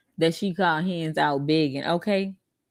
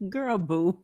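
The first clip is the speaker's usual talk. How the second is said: ordinary talk, nothing marked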